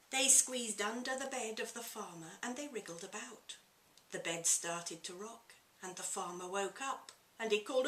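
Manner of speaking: lively picture-book reading aloud; story narration. A narrator's reading. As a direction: read it to children with relish.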